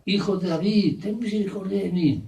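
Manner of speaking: acted pleading cry, pitched high; mock crying out. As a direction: call out for mercy, held back. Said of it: "por eso gritaba".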